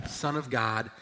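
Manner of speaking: normal talking voice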